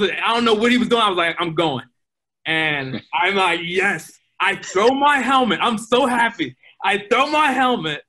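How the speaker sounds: thrilled replay of the winning moment; excited retelling. Real, not acted. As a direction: relive the thrill, voice lifted.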